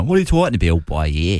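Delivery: guttural Waterford accent. Shown as a demonstration. Showing the radio host a Waterford accent, guttural. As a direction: Waterford local's throaty drawn vowels. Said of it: "this Waterford accent on the radio".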